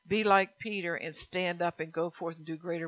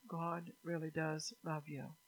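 ordinary talk, nothing marked

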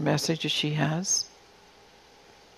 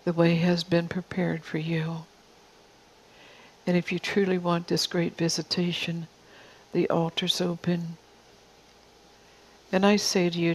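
softer, tender word spoken as the Father; soft prophetic voice; 4 dB quieter than usual. Acted. The Father inviting the congregation forward, soft and tender. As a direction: draw them close, gently, toward the altar.

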